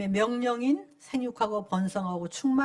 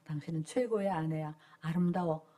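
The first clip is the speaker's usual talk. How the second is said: tender praise to wife; 8 semitones lower, 6 dB quieter than usual. Shown as a demonstration. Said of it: "말을 해야 돼".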